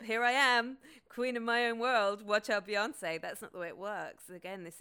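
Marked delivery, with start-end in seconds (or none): 0.0-3.2 loud, higher, grand self-announcement; hammed-up diva proclamation; mock triumph. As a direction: belt it out like a self-crowned queen.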